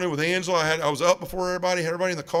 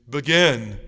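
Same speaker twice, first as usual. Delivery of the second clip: a lower, louder command from a gruff priest; stern priest command; 3 semitones lower, 5 dB louder than usual. Retold or imitated. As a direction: insist like an impatient old priest. Priest repeats his order firmly.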